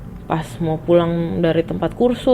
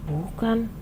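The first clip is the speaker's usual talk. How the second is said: soft, higher tearful denial; menangis; 5 semitones higher, 7 dB quieter than usual. Retold or imitated. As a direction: imitate a crying pupil, small and high. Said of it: "Dia nangis tuh". (lirih menirukan tangisan)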